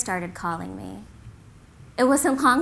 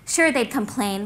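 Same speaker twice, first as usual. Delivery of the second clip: ordinary talk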